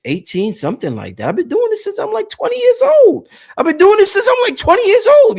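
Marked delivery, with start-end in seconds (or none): 1.3-5.4 much higher and louder, bursting with pride; excited boast. Real, not acted. Bragging to viewers about his twenty years, excited and high.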